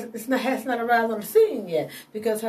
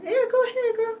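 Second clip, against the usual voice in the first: much higher, breezy encouraging voice; voicing God; 13 semitones higher than usual. Speaker voicing God telling him to tell his story, high and breezy. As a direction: give God a high, easy go-ahead. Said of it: "And I'm like".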